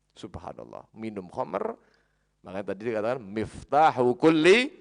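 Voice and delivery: ordinary delivery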